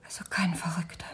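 low, faint whisper of dread; dismayed whisper. Acted. Wife realising the escaped convict is real, in a dismayed whisper. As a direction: let the realisation drain your voice.

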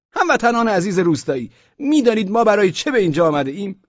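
high, loud, pompous official addressing a room; a self-important bureaucrat opening a meeting; Osman Bey's official voice. Acted. Osman Bey greets the merchants in a high, pompous official voice. Speech to villagers.

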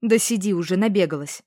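loud, exasperated snap; irritated. A narrator's reading. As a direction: wave off her nonsense. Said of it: "раздражённо отозвалась подруга".

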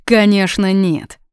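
ordinary delivery, nothing marked

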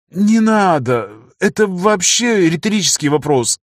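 высоко и медленно, жалобно; съёжившись, тянет оправдание; испуганно мямля. Acted. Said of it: "начинает мямлить". (испуганно мямлит, высоким голосом)

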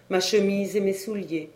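ordinary delivery

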